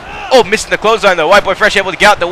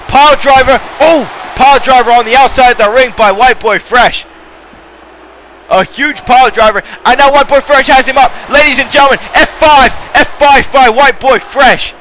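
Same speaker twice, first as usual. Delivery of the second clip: excited call; 5 semitones higher than usual. Real, not acted. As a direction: lean hard on every big move. Pile driver and F five calls.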